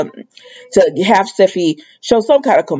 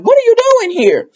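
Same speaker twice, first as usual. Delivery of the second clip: surprised Taylor. Retold or imitated.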